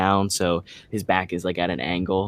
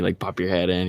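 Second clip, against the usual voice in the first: ordinary talk, nothing marked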